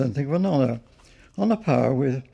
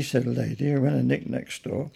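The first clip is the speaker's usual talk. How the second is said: ordinary delivery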